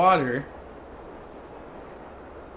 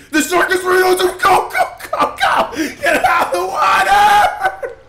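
high, loud laughing screams; screaming laughter fleeing the shark; panicked shout; 13 semitones higher, 7 dB louder, slower than usual. Real, not acted. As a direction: yell to get everyone out. Shark under him, fleeing.

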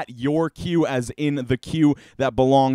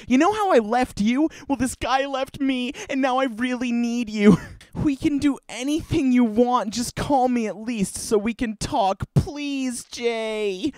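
put-on high whiny girly voice begging; girly pleading ex; 9 semitones higher than usual. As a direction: plead sweetly to win him back. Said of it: "So she's like".

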